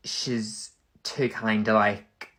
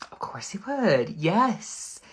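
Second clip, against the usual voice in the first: much higher, eager and delighted; excited verdict; 9 semitones higher, slower than usual. Real, not acted. A vlogger gushing excitedly that Andre would agree. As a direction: pitch it up, bursting with yes.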